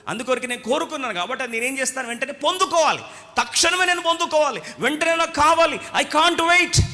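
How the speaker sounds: preacher acting out impatient craving, shouting; craving rising to shout. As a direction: climb to a desperate I can't wait. Sermon voicing craving self.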